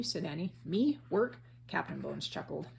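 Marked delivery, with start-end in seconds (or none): 0.7-1.4 scoffing. A narrator's reading. Captain scoffs at working.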